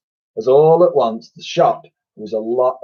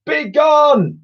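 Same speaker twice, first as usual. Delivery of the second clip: very high, loud scream; 5 dB louder than usual. A narrator's reading. Twins screaming at the slime. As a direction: scream it away. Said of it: "they both screamed".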